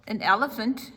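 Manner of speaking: plain reading voice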